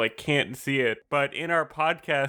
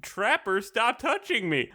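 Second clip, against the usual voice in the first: higher-pitched mock tattling; childish complaint; 8 semitones higher than usual. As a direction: imitate a kid complaining about a poke. Acts out childish bickering.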